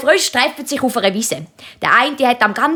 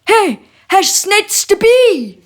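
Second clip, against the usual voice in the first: higher, calling spider husband; 9 semitones higher, 3 dB louder than usual. Acted. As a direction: call after her, higher. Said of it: "ruft das Spinnenmännli".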